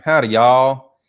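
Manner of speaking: Southern accent. Shown as a demonstration. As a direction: put on a hearty Southern accent. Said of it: "it's, like, the Southern accent".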